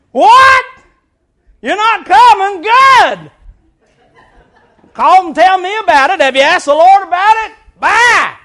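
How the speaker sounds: loud, high, sarcastic brush-off; mock dismissive shout. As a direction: bark it down the phone, mocking. Acted phone call brushing off member.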